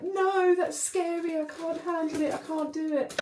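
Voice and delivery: high, whiny mock dread; mock panicked protest. Dreading being an adult, in high mock panic. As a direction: protest in a squeaky, overwhelmed voice.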